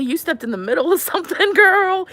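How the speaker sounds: high, quick words breaking into laughter; laughing welcome. Real, not acted. Jokey welcome to a newcomer mid-cry.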